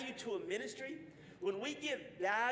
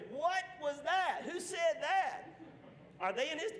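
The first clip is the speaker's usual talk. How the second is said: shocked shout; 7 semitones higher than usual. Retold or imitated. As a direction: call out in mock shock. Indignant golfer overhears swearing.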